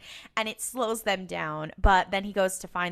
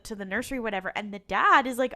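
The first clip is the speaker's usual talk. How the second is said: ordinary talk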